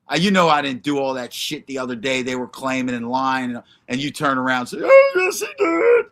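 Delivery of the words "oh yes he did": high, hammed-up mocking voice; mock quote. Streamer mimicking the chat accusing him, in a high sneering squeal. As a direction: squeal it out like a taunting tattletale.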